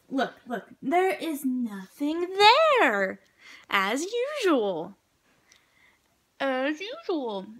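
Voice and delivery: loud, drawn-out exasperated scolding; yelling at the dog. Real, not acted. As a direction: scold the dog, stretching the words up high. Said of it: "let's go yell at her".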